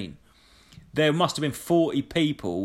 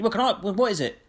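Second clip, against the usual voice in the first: flustered official; 8 semitones higher, faster than usual. Retold or imitated. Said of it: "She went".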